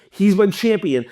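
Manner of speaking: normal talking voice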